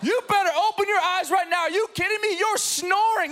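mock the furious wife, high and sharp; outraged wife. Wife catching husband snoring in labor room, high outraged scold. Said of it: "my wife was like".

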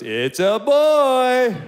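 loud, high, long drawn-out proclamation; doctor's big announcement. Retold or imitated. Doctor correcting the parents at birth, booming announcement. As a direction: belt it out slowly, stretching the last word. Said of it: "the doctor goes".

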